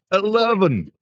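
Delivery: high, slow Scottish "eleven"; drawn-out Scottish accent, pitched well up. Acted. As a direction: mimic a Scotsman at a voice lift. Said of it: "a good idea in Scotland".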